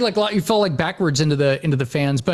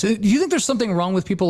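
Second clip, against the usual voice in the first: ordinary talk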